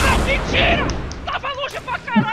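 shocked yelp at the kill; panicking gamer. Real, not acted. Disbelief after being shot.

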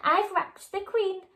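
high, mock-tearful wail; voiced as if about to cry, rising to the moon; sobbing. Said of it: "sobbed Scarlett".

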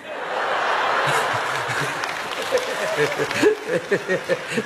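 a rising burst of laughter; real laugh. Real, not acted. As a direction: break up laughing.

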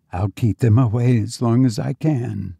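plain reading voice

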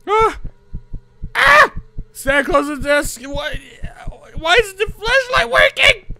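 high frantic yelling; panicked shouting. Real, not acted. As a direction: yell in alarm. Chased again, shouting.

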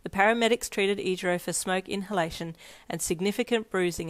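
ordinary delivery

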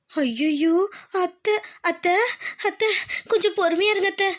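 high-pitched, panicky appeal; anxious pleading. Acted. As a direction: beg an angry mother-in-law to be patient. Pleading with furious mother-in-law. (பதற்றத்தோடு கெஞ்சும் குரலில்)

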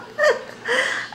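a high squeaky laugh; high nervous giggle. Acted. Caught hiding by mom.

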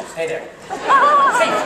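ordinary talk

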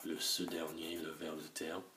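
usual speaking voice

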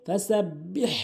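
ফাসাব্বিহ with the double b held, rising at the end; correct pronunciation. Shown as a demonstration. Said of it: "বলতে হবে". (শুদ্ধ উচ্চারণে ফাসাব্বিহ, ব্ব টেনে)

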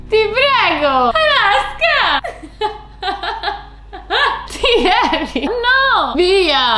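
high, drawn-out wail at the cat; half-laughing exasperated cry; exasperated pleading. Real, not acted. Scolds the cat stealing the scene.

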